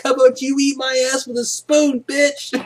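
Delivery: put-on cheeky teen mouthing off; bratty student comeback. Student sassing the teacher, high squeaky taunt. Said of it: "That was me".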